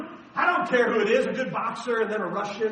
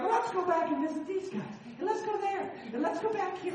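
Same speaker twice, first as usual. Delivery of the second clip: a lightly voiced, higher breezy apostle; apostles planning; 10 semitones higher than usual. Paul and Barnabas planning a trip back, lightly higher and breezy. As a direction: toss off the plan as if it's nothing. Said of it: "And now they're like".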